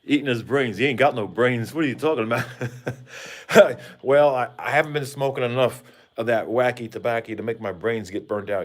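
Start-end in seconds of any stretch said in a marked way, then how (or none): 2.5-4.0 hearty laugh. Real, not acted. Laughs at own joke.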